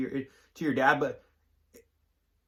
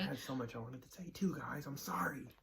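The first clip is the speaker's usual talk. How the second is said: soft apologetic whisper; 13 dB quieter than usual. Real, not acted. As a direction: whisper it, barely voiced. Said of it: "Oh, I'm gonna be quiet".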